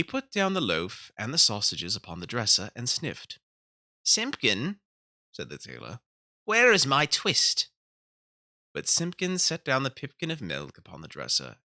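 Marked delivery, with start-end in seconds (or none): 4.0-4.8 high, louder call to the cat; calling old tailor. Acted. 6.4-7.7 high, louder, slow pleading question; anxious old tailor. Acted. Tailor asks the cat.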